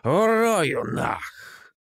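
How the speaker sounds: slow, slurred shouted threat; drunk threat. Acted. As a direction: drag it out like a furious drunk. Drunk threatens to beat him.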